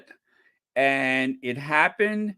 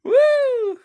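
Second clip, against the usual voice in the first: loud high whoop; 7 dB louder than usual. Real, not acted. Excited whoop after the rant.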